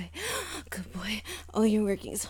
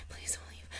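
breathy whispered begging; hushed, desperate don't leave; whispered plea; 10 dB quieter than usual. Acted. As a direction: beg in a bare whisper. Pleading repeated as a whisper.

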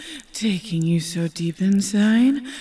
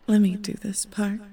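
ordinary talk

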